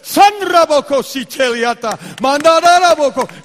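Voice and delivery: loud, high fervent tongues; praying in tongues. Retold or imitated. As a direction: pour it out in ecstatic tongues. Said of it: "She's praying in tongues".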